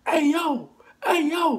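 mock call from a distance. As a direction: shout after the thieves from down the street. Men calling out after them.

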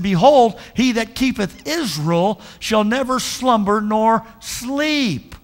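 elevated reading voice; reading scripture. Shown as a demonstration. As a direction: read it out reverently, slowly. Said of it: "reads verse".

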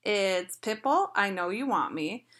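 ordinary talk, nothing marked

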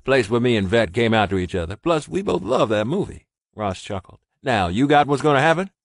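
a fond laugh in it; chuckling Ross. A narrator's reading. Ross explaining the password, chuckling.

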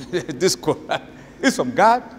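slow, laughing incredulous question; mocking disbelief. Skeptic laughing at the Quran claim, slow mock.

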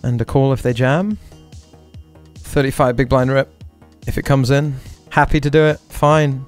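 ordinary talk

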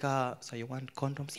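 low, hushed question; discreet pharmacist. Acted. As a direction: ask softly and discreetly. Pharmacist asks discreetly about condoms.

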